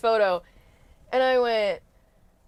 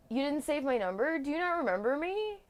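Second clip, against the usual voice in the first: mock hurt texter; 6 semitones higher, 5 dB quieter than usual. Quoting the scam texter.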